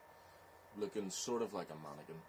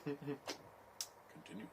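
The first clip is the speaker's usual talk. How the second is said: mock whispered echo; 8 semitones higher, 6 dB quieter, much slower than usual. Echoing friend's word.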